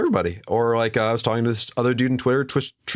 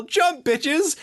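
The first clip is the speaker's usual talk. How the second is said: mock yell. Yelled line in read quote.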